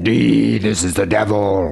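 drawn-out heavy metal Satan voice; voice-emulator devil. Shown as a demonstration. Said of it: "voice emulator or something".

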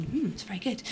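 usual speaking voice

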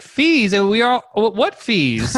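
high-pitched baffled protest; incredulous. Retold or imitated. Buyer challenges manager on fees, incredulous. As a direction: question it in rising disbelief, voice high. Acts his disbelief at fees.

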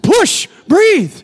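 mock movie doctor yelling at a woman in labour; doctor yelling orders. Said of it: "he's yelling".

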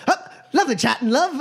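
high-pitched, quick goodbye; hasty farewell. Acted. Magician fleeing the scene, high hasty farewell. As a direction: rattle off a high getaway line. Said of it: "he goes".

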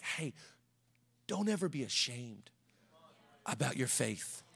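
low, soft, spaced-out counsel; gentle admonition. Retold or imitated. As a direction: voice a quiet inner prompting to a child. Voices the Holy Spirit gently admonishing.

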